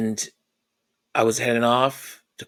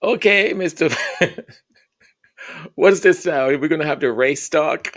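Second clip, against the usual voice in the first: mocking retort; 5 dB louder than usual. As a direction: tease him with the reply. Son's mocking retort to dad.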